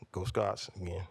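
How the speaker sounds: usual speaking voice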